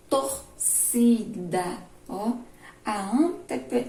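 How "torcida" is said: torcida syllable by syllable, "ci" punched; stress on penultimate syllable. Shown as a demonstration. Teacher showing pupils the penultimate syllable, "ci" stressed in torcida. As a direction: stretch out torcida and hit the middle "ci". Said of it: "a penúltima é a mais forte".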